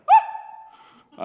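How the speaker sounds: sudden high comic shriek; short, loud, high yelp; imitated shriek. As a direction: let out a quick cartoonish scream. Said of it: "in my head, I go".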